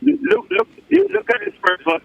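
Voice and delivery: usual speaking voice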